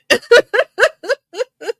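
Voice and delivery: high, loud run of laughter; real laugh. Real, not acted. Co-host unsure what the proverb means, laughing loudly. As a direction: burst out laughing in high, repeated peals. Amused at own uncertain answer.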